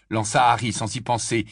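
ordinary delivery

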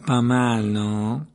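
mock-sweet gloating; a touch of oily smugness; smooth, sly. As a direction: fish slyly for admiration. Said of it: "dit Malfoy d'une voix doucereuse".

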